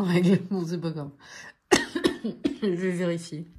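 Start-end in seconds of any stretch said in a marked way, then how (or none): 1.7-2.6 sharp choking cough. Real, not acted. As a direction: cough as if something went down wrong. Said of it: "je m'étouffe". (toux d'étouffement)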